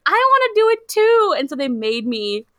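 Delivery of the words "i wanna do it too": put-on high whiny kid-sister voice; annoying little sister. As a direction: whine it like a tagalong sibling. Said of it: "the annoying sister that's like".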